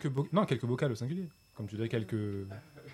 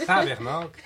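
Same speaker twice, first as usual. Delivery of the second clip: mock Québécois; slower than usual. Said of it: "le Québécois".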